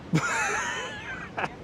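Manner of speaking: high breathless laugh; squealing laugh. Real, not acted. Laugh after joke.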